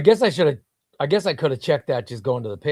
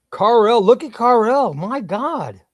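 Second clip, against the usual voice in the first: excited; 7 semitones higher, 6 dB louder, slower than usual. Real, not acted. Sees viewer's art video.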